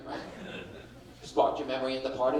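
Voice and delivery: usual speaking voice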